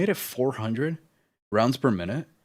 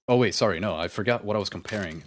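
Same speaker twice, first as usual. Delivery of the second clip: ordinary talk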